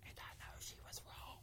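hushed, secretive whisper; barely voiced confidential aside. Real, not acted.

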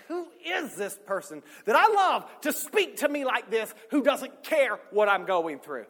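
loud, high shout through gritted teeth; re-enacted tearful anger, voice way up; angry outburst. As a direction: shout it in hurt disbelief. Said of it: "I'm angry. My teeth are gritted".

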